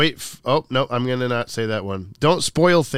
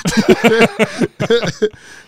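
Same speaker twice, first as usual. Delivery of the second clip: burst of laughter; 10 semitones higher, 7 dB louder than usual. Real, not acted. Laughs at the comment.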